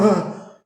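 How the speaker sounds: high startled shout; a hammed-up surprised cry; quick high yell; mock yelp. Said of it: "He's like".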